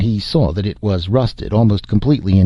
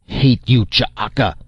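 higher-pitched hostile call, only mildly raised; restrained story-reader's shout; mock shout; 4 semitones higher, slower than usual. A narrator's reading. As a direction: call out a threat as if running up. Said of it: "Fasimba shouted as he ran up".

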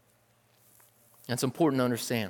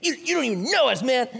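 higher, indignant challenge with a laugh; upset religious leaders; 8 semitones higher than usual. Retold or imitated. Religious leaders challenging Jesus, mock indignant and higher. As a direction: protest like an offended leader. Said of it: "they're like".

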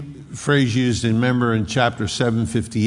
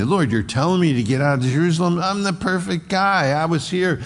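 Paul arguing; 6 semitones higher, faster than usual. Acted. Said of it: "Paul argues with him".

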